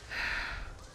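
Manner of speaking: soft breathy sigh; disappointed sigh. Real, not acted. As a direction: let out a let-down sigh. After sneeze fails.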